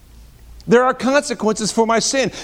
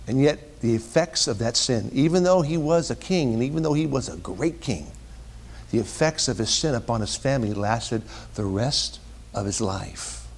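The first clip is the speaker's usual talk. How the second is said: low, soft, weighty sermon voice; solemn preaching; 8 semitones lower, 8 dB quieter than usual. Real, not acted. Preacher on sin's lasting penalty, solemn hushed preaching. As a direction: let each point sink in gravely, with long pauses.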